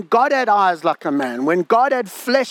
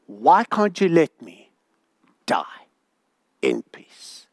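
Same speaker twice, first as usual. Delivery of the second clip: fading to a whisper; 6 semitones lower than usual. Acted. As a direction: drop to a whisper on the last words. Job begging God for death.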